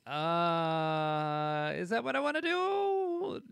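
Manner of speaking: squeaky, drawn-out questioning; high mock doubt. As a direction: pitch way up, unsure and slow.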